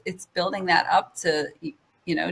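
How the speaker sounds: ordinary talk, nothing marked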